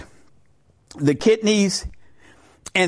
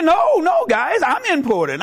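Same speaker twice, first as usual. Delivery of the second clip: high-pitched insistent objection; comic indignant pushback; mock protest; 8 semitones higher than usual. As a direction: protest your importance, voice jumping up. Rectum speaks up to protest.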